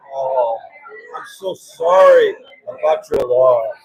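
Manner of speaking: slow, low mock condolence; fake sympathy. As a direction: draw out an insincere "so sorry". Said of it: "then they go like this".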